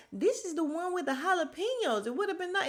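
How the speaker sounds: high, put-on voice, talking about the cookie; breezy society lady mimicry; mimicking Sutton. Vlogger mimicking Sutton talking about the cookie, high and airy. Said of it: "she's like".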